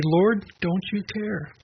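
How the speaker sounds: slow, high, hurt plea; plaintive plea. Retold or imitated. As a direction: ask it slowly as if feeling abandoned. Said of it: "our thought might be".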